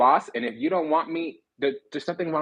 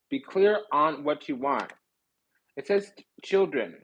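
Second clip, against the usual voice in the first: ordinary talk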